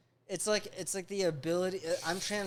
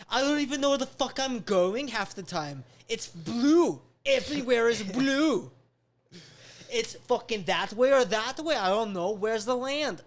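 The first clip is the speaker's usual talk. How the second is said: loud, higher-pitched comic exasperation; exasperated rant; 4 semitones higher, 6 dB louder than usual. Acted. Lost seafarer complaining about finding land, exasperated. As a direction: rant like a hopelessly lost sailor, punching it out.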